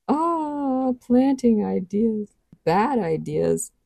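slow, sneering taunt drawn out; mocking soldier. A narrator's reading. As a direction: tease them like a predator. Soldier taunting, voiced figure.

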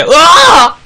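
loud high falling scream; screaming while falling. Real, not acted. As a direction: yell out as you drop. Scream during game.

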